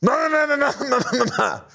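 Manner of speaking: high, garbled string of no's; mimicking an unintelligible old man; old man yelling. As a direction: squawk it like the old hiker. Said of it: "And he goes".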